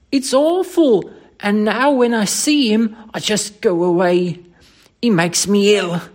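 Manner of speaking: high, loud Cockney distress; horrified loathing. Acted. Thomas tells his employer the man sickens him, horrified Cockney. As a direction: wail it in appalled Cockney. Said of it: "utter loathing and horror of Thomas's".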